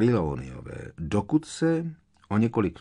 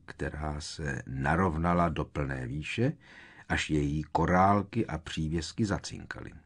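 usual speaking voice

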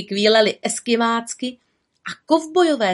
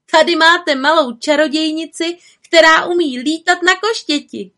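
much higher and louder calling to the crowd; high loud call to the children; 8 semitones higher, 4 dB louder than usual. A narrator's reading. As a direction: summon everyone across the square. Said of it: "a zavolala".

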